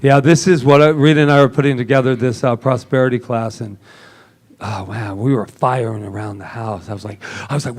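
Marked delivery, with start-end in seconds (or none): none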